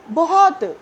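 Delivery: a loud, high, emphatic बहुत; stress on बहुत. Real, not acted. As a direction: lean hard on बहुत. Stressing how much love.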